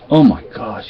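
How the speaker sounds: ordinary talk, nothing marked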